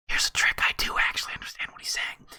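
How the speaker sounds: whispered secret aside; confiding stage whisper. Pretend. Narrator lets viewers in on a trick, whispering. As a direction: drop into a quick, hushed whisper.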